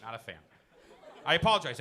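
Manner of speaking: usual speaking voice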